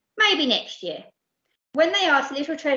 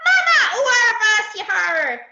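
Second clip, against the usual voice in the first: put-on very high, loud whooping baby cry; joyful hoot; 10 semitones higher, 7 dB louder than usual. Baby hoots happily on seeing his mother. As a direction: squeal with delight at seeing mum. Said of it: "he let out a whoop and hooted".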